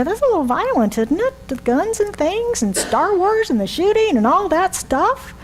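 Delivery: high, prissy tut-tutting; mock disapproval. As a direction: play the scandalised modern viewer. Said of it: "we'd look at that and go".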